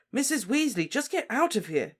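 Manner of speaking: Harry shouts at Mrs Weasley to leave, high and loud. Acted. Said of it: "shouted Harry".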